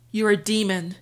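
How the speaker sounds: loud, furious spitting of the words; a loud seething accusation. Acted. Cornered vampire hurls loud seething insult at narrator. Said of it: "he seethed".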